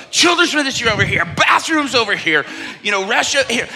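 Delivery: high, shouted mock-panicked announcements; frantic announcing. Pastor moving all over the stage, frantic mock announcements. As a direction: call out directions while darting about in hammed-up panic.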